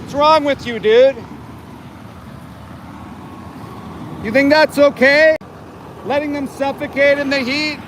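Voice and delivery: shouting at the truck driver in the heat; yelling at the driver. Real, not acted. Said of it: "Sorry for the yelling".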